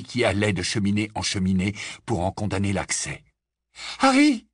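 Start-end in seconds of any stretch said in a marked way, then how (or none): 4.0-4.5 high, loud squeaked name; a startled squeak of alarm. A narrator's reading. Hermione to Harry as trouble begins, squeaking. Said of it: "couina Hermione".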